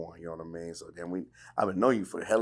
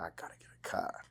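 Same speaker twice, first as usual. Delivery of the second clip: hushed, as if plotting; whispered thought; 13 dB quieter than usual. Retold or imitated. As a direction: whisper it to yourself. Retold inner thought, whispered.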